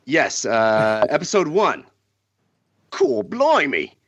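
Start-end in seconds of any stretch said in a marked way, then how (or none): 1.1-4.0 lightly done Cockney, relishing the slang; Cockney accent. Pretend. [name] reads the parody plot list for the podcast in a light Cockney accent. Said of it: "with your best accent".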